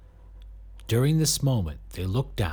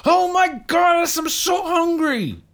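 desperate whine; 7 dB louder than usual. A narrator's reading. Desperate hungry exclamation.

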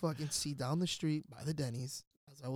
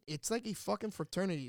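usual speaking voice

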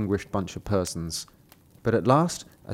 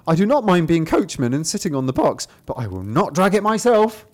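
indignant rooster; 6 semitones higher, 4 dB louder than usual. Acted. Cock refusing, voiced.

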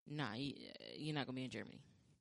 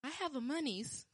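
higher, childish mock grammar; baby talk; 11 semitones higher than usual. Mocking her own excuse, higher baby talk. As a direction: say it like a toddler.

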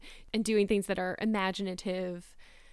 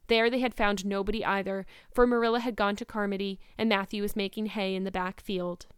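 plain reading voice

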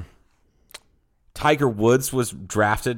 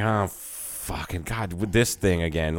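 exasperated; 5 semitones lower, 5 dB quieter, slower than usual. Retold or imitated. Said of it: "they're like".

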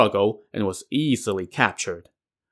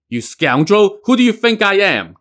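loud, rising much higher in anger; angry retort; 10 semitones higher, 7 dB louder than usual. A narrator's reading. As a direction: hurl it at the trapped assassin. Captor reveals himself angrily.

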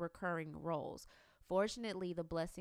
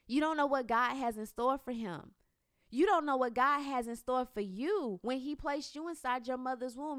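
fervent preaching; 5 semitones higher, 6 dB louder than usual. Real, not acted. Preaching the pastor's words.

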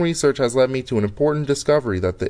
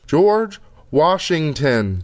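slow and clear; much slower than usual. Acted. Repeating to voice system.

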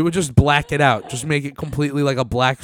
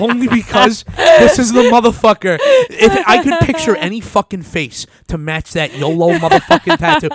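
excited; 8 semitones higher, 7 dB louder than usual. Real, not acted. Gleeful rant about the tattoo.

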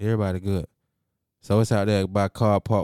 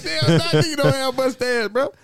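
laughing. Retold or imitated. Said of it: "I'm like".

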